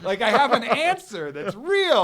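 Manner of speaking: high, loud and drawn-out amazement; laughing, high-pitched elated discovery; thrilled realization. Retold or imitated. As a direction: excitedly exclaim it, stretching the words. Retells thrilled discovery of his people.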